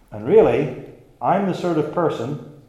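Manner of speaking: mock self-important assertion; boastful. Preacher imitates a boasting believer, self-important. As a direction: brag as the hypothetical hard man. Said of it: "who boasts of being a".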